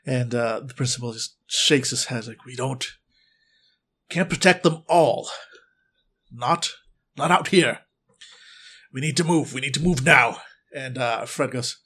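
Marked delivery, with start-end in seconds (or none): 2.5-10.4 louder, urgent command. Acted. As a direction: push the group to move now. Principal urging group to flee.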